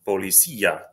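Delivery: policía as po-li-SÍ-a, stress on the í; stressed í hiatus. Shown as a demonstration. Teacher modelling policía for learners, stressed í in its own syllable. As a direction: hold the í of policía apart from the a. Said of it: "they're separate syllables because that I accent".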